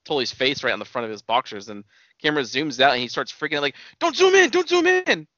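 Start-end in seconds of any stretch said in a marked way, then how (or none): 4.0-5.3 much higher, louder mock panicked plea. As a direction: shriek it like a wrestler caught on camera. Retelling him freaking out.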